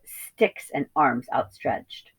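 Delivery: plain reading voice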